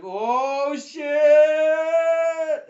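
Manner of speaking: high, loud sing-song "Oh, shit!"; loud shocked cry. Acted. Janak reacts as fight breaks out, loud shocked cry. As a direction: blurt it out in loud alarm. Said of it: "Janak was like".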